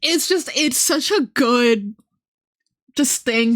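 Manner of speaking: ordinary delivery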